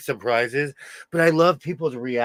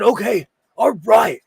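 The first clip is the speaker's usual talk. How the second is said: loud dad reply; 7 semitones higher, 7 dB louder than usual. Retold or imitated. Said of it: "He said".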